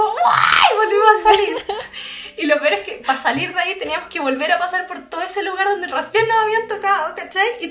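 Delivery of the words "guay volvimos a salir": loud, slow shouted yelp half laughing; laughing shout of fright; panicked shout. Retold or imitated. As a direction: yell it in retold panic. Panicked retelling of fleeing.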